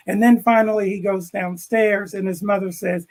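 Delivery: ordinary talk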